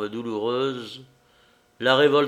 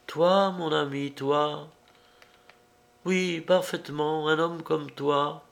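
higher, lightly voiced strange young woman; higher-voiced girl, eerily calm; woman's voice; 5 semitones higher than usual. A narrator's reading. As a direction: speak as the odd, intense girl. Woman character speaking.

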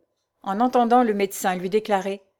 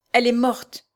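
ordinary delivery

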